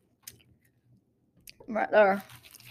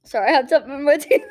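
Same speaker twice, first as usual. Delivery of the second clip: laughing; 5 semitones higher, faster than usual. Real, not acted. Laughing at own slip.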